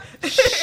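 high loud laugh. Real, not acted. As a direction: burst out laughing. Laughs at manipulate remark.

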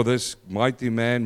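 ordinary talk, nothing marked

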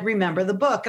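ordinary delivery

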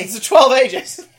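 loud, high, amused objection; laughing mock reproach. Director reminding actor of rating, laughing scolding.

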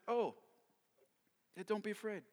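soft, offhand reassurance; calm, unbothered. Acted. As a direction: brush off the panic softly. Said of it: "Elisha calmly declares".

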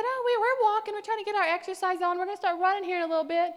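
high, loud, quickening explanation; chirpy friendly reply. Retold or imitated. Woman explaining the run to him, brightly friendly. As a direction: imitate an upbeat, hurried answer. Retold friendly answer to young man.

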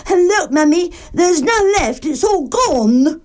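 little bear tells his mummy, high and upset; Baby Bear crying. Acted. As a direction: squeak it out like an upset cub. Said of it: "and cried".

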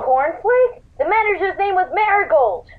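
can't believe the wrong name; shocked disbelief. Acted. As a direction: pitch jumps up in disbelief.

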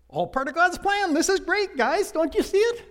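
high, loud overly cheerful voice; mock cheerful. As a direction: chirp it like a naive optimist. Mock cheerful Joseph quote.

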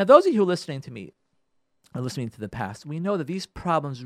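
normal talking voice

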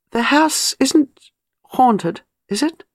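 a demanding question, read slowly. A narrator's reading. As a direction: press for an answer, unsettled. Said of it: "demanded Gwenda".

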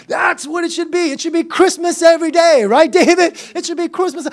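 high, excited insistence; exuberant preaching. Real, not acted. Preacher urging church to joy like Christmas, exuberant and high. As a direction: exhort with Christmas-morning excitement.

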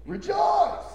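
high, loud exultant call; preacher's ringing cry; cried out rejoice. Acted. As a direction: fling rejoice up to the rafters.

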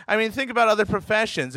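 ordinary talk

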